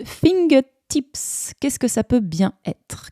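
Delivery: "Fingertips" high and drawn out; riddle prompt. Shown as a demonstration. Teacher setting learners a riddle, slow teasing prompt on "Fingertips". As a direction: stretch "Fingertips" slowly from a high start, as a teaser. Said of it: "une devinette".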